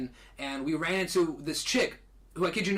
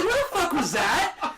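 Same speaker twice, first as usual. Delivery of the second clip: high, loud and slow, half-laughing; mock-furious, laughing through it; retold jealous outburst, hammed up with laughter; retold angry demand; 12 semitones higher, 5 dB louder, slower than usual. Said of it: "I was pissed".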